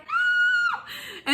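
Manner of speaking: very high shrieked no; piercing no, pushing the door shut; shrieking refusal. Retold or imitated. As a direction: shriek it to keep him out. Holding door against brother.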